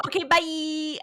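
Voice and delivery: high, comic imitation of a hasty exit; flippant goodbye. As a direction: sing out a mock farewell. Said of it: "I literally wrote".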